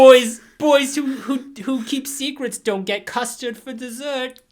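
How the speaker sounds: creepy Lin Shaye mom. Retold or imitated. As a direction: warn like an eerie sweet-voiced mother. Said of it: "a young Lin Shaye vibe".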